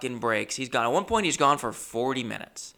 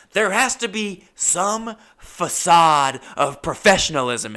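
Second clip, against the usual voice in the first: loud, slow, raised-pitch mock outrage; indignant outburst; 8 semitones higher, 8 dB louder, slower than usual. Customer confronting the boss about his shop, loudly indignant. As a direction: act out a loud, drawn-out protest.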